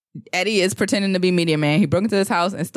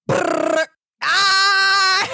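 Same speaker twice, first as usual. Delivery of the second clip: gunfire imitation attempt; 12 semitones higher than usual. Shown as a demonstration. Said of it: "My turn to try".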